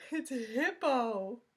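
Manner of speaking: slow, loud, breathless with laughter; gasping through laughs. A narrator's reading. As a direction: squeeze the name out between laughs. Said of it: "gasped the rhinoceros".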